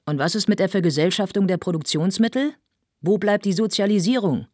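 loud, high-pitched heckling; shouted challenge. A narrator's reading. Someone in the assembly challenges the speaker, shouting. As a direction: demand an answer from across the hall. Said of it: "rief es aus der Versammlung".

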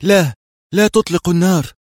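high, loud exclamation; calling out. A narrator's reading. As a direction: call out a plea to hold fire. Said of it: "رفع ذراعيه هاتفا".

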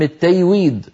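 التيويد with the jim turned into y. Shown as a demonstration. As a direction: say التيويد as tayweed. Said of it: "يقلبونه ياء". (قلب الجيم ياء)

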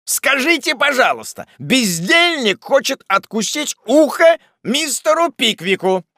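high, loud outraged exclamation; outraged, high-pitched yelling; indignant shout. Acted. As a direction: shout in scandalised disbelief. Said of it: "закричал Уардель".

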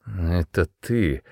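slow, thick-tongued slurring; slurred drunk mumble. A narrator's reading. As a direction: mumble it, tongue barely moving. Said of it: "пробормотал он невнятно, еле ворочая языком".

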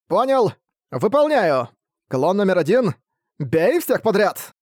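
high, eager servant voice; bright eager yes-man; бодрый вампир. Acted. Вампир Рокард бодро отвечает хозяину. (бодрый голос слуги-вампира)

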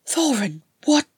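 blurted. Acted. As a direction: blurt it out, caught off guard. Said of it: "Frerin blurted".

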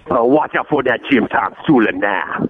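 low growly Cajun coach voice; Ed Orgeron impression. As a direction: do a deep Louisiana coach. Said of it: "Listeners fake Ed Orgeron".

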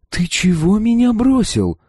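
put-on high-pitched voice of an indignant young woman; indignant girl. A narrator's reading. Girl confronting the man who left her, indignant. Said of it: "сразу возмущённо спросила та".

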